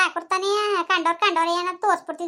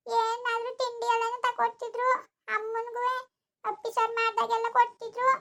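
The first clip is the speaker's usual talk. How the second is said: tearful; 5 semitones higher than usual. Acted. Child asks grandfather whether mother was wrong, tearful. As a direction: plead your case, upset and tearful. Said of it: "ಅಳ್ಬೇಡ".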